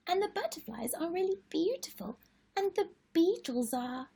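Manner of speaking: put-on high excited little girl voice, pleading; little sister Lola. Lola begging Charlie for her favourite book, high and pleading. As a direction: plead like an eager small girl. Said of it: "And Lola says".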